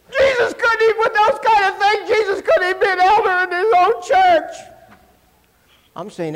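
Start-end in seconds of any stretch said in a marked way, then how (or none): 0.1-4.6 high, whiny mock tearful voice; hammed-up tearful whine; mock crying. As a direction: mimic a preacher weeping over it. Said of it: "this guy starts crying".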